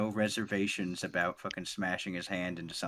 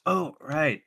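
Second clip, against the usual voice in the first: a mild oh-right, higher and louder; lightly voiced forgetful mentor; hammed-up forehead smack; sudden realization; 5 semitones higher, 5 dB louder than usual. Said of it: "smacks his forehead and goes".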